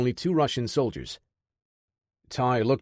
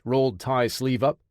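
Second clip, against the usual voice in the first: plain reading voice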